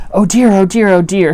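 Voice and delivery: loud, fretful self-talk; mildly alarmed storybook muttering of dismay; worried Piglet. A narrator's reading. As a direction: fret aloud to yourself. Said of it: "said Piglet to himself".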